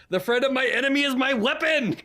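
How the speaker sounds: booming high war cry mid-suplex; battle roar. Acted. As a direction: roar it like a wrestler slamming a foe. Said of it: "he goes".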